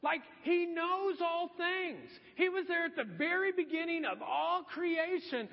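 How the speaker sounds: hint of a grand, all-knowing Jesus; all-knowing one. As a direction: draw it out like you were there at creation. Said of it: "he's like the omniscient one".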